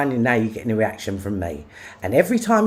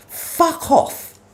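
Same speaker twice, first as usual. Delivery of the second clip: much higher, fed-up blurt; angry dismissal; 14 semitones higher than usual. Real, not acted. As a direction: throw it out in real disgust. Furious rant at online critics.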